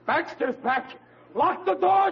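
sudden mock terror at the news; panicked alarm. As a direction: yell to bar the door in fright. Panic at uncle's return.

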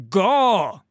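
furious high roar. A narrator's reading. Halla roaring at Sigvar to go, furious roar. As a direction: roar the word at full force. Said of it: "she roared".

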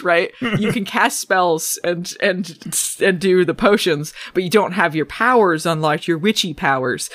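usual speaking voice

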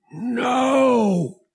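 high cry on the verge of panic; frustrated yell. A narrator's reading. Pilot caught with engines straining, frustrated yell. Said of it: "he yelled, frustrated, on the verge of panic".

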